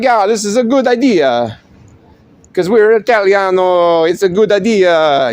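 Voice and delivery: cheerful mock Italian agreement; Italian accent. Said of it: "'Cause they all talk like that".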